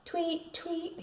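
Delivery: high, soft mocking birdsong; teasing. A narrator's reading. Brother teasing his sister, high mock tweeting. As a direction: tease her with sweet tweets. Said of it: "he teased".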